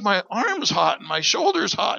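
amazed niece. Retold or imitated. Fighter niece telling the family her arm is hot, high amazed. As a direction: marvel at the heat, stretching the words.

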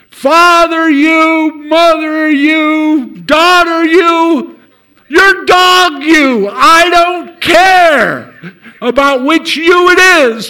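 high, loud, slow mock shout. Preacher bellowing at the father, slow loud shout.

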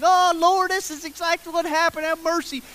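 high, loud-starting imitated outcry; hammed-up confession blurted to everyone; yelling it out. Trembling woman confessing before the crowd, imitated yell. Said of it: "so she yells out everything".

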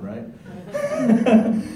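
high laughing teasing right; laughing jab. Real, not acted. Preacher teasing the sound crew, laughing. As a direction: tease the sound guys through a laugh. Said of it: "Especially those guys who run sound".

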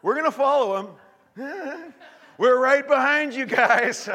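high, loud laughing jest; laughing mock. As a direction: tease the soldiers. Mocking soldiers behind choir.

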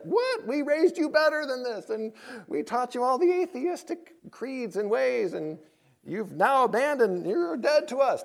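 high, indignant parental scolding; mock parental outrage, pitched high; mock outraged parents. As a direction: play the scandalized atheist parents, hammed-up.

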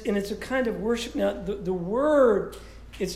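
normal talking voice

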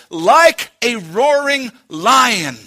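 "like a roaring lion" slow and weighty; underlined phrase. Real, not acted. Preacher underlining scripture for church, "like a roaring lion" slow and emphatic. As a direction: draw out "like a roaring lion", each word underlined. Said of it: "underline this".

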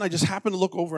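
normal talking voice

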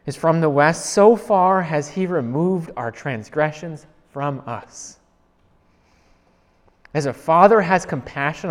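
slow, loud, solemn recitation; fervent scripture recital; psalm reading. Acted. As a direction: proclaim the psalm slowly like worship.

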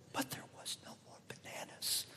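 hushed, tearful whisper. Retold or imitated. Mom confiding to her son, whispered. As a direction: breathe it out as a sad secret. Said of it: "she says to me".

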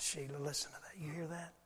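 hushed whisper to his wife. Retold or imitated. Husband whispering to his wife on the plane, hushed. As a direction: lean in and whisper so no one hears.

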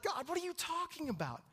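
high, indignant outburst at God; reenacted exasperated protest; mad retort. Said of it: "I got mad".

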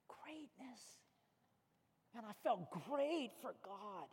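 very soft, savoring the word; quiet, reverent wonder; hushed awe. Real, not acted. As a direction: let it fall almost to a whisper. Savoring the story's climax.

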